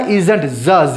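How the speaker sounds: "isn't" with a voiced buzzing z, then the sound alone. Shown as a demonstration. Teacher showing learners how to read isn't, Z sound. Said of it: "जेड का sound आएगा".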